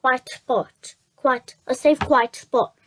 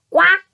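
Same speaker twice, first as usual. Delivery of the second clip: a bright, higher, louder little quack; mock duck quack; 4 semitones higher, 5 dB louder than usual. Jemima greets the gentleman with a mock quack.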